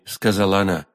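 usual speaking voice

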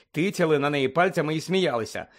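plain reading voice

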